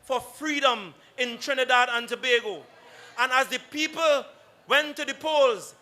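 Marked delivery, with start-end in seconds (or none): 0.0-2.6 loud, fiery rally speech; roaring rallying speech. Real, not acted. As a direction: rouse the crowd at full volume.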